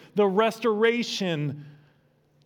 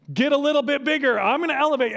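mock getting louder; 6 semitones higher, 3 dB louder, much faster than usual. Shown as a demonstration. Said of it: "So then I".

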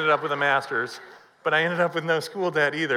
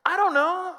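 much higher, helpless squeak; squeaky panicked I don't know; 14 semitones higher than usual. Retold or imitated. To his wife over the bill, a high helpless squeak.

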